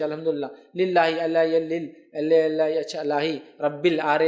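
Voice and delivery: imitating a learner stumbling over الحمدللہ, breaking it into ال and اچ bits; hammed-up stammering read of romanized Arabic, slowing down; mock halting reading. Shown as a demonstration. Teacher mimicking a romanized-Quran reader, choppy and halting. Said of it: "یہ بولنا چاہ رہے ہیں".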